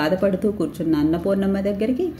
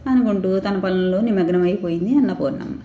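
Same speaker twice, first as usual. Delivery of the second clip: usual speaking voice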